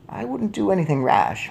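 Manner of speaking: put-on high, composed old lady; cautioning grandmother. A narrator's reading. Grandmother cautions furious father, composed. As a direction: warn him mildly.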